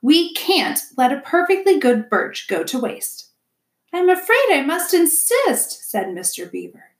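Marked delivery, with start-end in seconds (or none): 0.0-3.3 wifely objection. A narrator's reading. Wife overrules her husband. 3.9-5.9 much higher, louder stubborn beaver; put-on voice of an obstinate husband; stubborn insistence. A narrator's reading. Mister Beaver refuses the gift.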